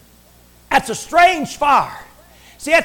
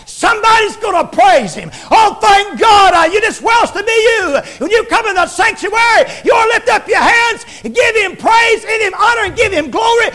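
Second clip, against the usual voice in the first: preacher urging the church to praise; full-force preaching; 7 semitones higher than usual. Acted. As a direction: shout them into praise.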